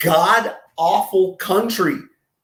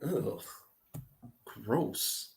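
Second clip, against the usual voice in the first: disgusted muttering; 5 semitones lower, 19 dB quieter, much slower than usual. Real, not acted.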